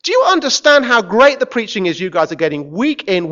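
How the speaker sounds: normal talking voice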